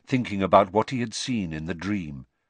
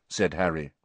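ordinary delivery, nothing marked

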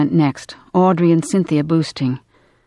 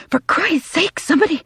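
high, strained plea; gasped; 12 semitones higher than usual. A narrator's reading. Woman holding up a body, high gasping plea. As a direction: plead urgently, straining under a weight. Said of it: "Cynthia gasped".

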